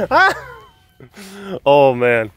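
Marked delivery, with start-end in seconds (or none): 0.1-0.6 high dismayed yelp; a sudden shriek at the mess; spilled hummus, high shriek. Real, not acted. Reliving the spill.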